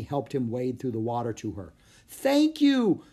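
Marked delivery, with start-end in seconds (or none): 2.1-3.0 high, loud yell of thanks. A narrator's reading. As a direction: shout gratitude over the wind. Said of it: "the woman yelled".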